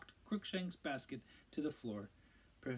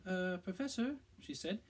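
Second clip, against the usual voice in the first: cautious Hermione; 9 semitones higher than usual. A narrator's reading. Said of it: "approached Professor Lupin cautiously".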